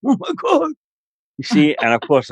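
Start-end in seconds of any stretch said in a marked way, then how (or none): 0.1-0.7 mock fright. Frightened by ghost strangling him.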